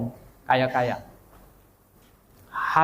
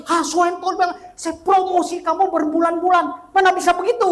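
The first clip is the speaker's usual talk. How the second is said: retold angry rebuke, much higher and a bit louder; 3 dB louder than usual. Friend scolding the preacher for missing his sermon, angry. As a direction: scold him as a let-down friend. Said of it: "Dia marah sekali".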